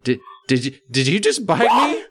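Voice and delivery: stammered start, pitch leaping high at the end; stammer, then squeal the question in shock; horrified disbelief. A narrator's reading. Victim's horrified question, acted.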